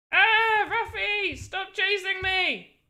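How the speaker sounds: high mock-frightened cry, drawn out; playful panic. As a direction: wail as if being chased. Chased by game character.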